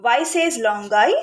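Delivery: usual speaking voice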